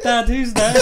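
high, loud mock child through laughter; laughing child voice. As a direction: squeal it like a scared kid while laughing.

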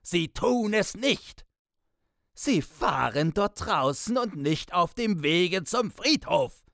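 high-pitched, frenzied bellow; enraged roar, far above normal pitch; screaming and cursing. A narrator's reading. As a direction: scold at the top of your voice, barely human. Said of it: "Man konnte es ein Gebrüll heißen".

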